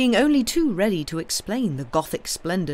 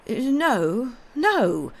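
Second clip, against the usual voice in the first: weary, high-pitched protest; wild but worn-out refusal; wild fatigue; 6 semitones higher, 3 dB louder, much slower than usual. A narrator's reading. Bohun rejecting the doctor's suspicion, wildly weary. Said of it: "with a sort of wild fatigue".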